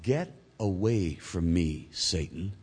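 slow, low, measured rebuke; quietly stern, each word weighed; Jesus, stern. Acted. Jesus rebuking Peter, stern and soft. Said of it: "said sternly".

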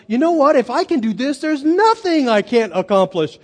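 high, loud burst of retold elation; triumphant confidence. Preacher recalling beating his cussing habit, triumphant. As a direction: proclaim it like you can conquer anything. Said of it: "And I felt like".

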